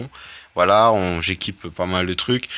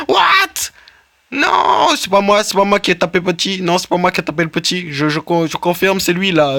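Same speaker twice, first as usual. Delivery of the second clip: much higher, loud squeaky protest of innocence; mock-innocent game character; 10 semitones higher, 5 dB louder than usual. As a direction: play the guilty creature swearing it wasn't him. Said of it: "c'est pas très, très, très convaincant".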